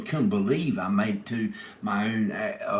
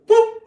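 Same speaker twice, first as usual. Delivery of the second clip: sudden high loud whoop; 11 dB louder than usual. Retold or imitated. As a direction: yelp it out like a cartoon. Pit crew guy's sound effect.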